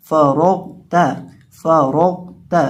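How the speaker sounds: फरौता with thick R and ghain, light ता; loud, deliberate फरौता, full in the middle, thin at the end; heavy R, thin T. Shown as a demonstration. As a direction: darken रौ, keep ता light in फरौता. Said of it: "इसको भी मोटा करके पढ़ेंगे".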